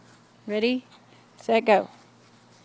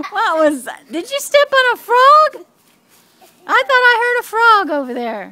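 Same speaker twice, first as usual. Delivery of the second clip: playful teasing, higher and louder; 10 semitones higher, 9 dB louder than usual. Real, not acted. Grandma teasing grandkid, playful high and loud.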